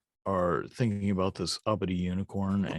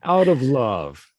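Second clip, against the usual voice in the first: mock-earnest cry; 3 semitones higher, 8 dB louder than usual. Game master answers the betrayal cry, mock-earnest. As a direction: draw it out loudly, hammed-up.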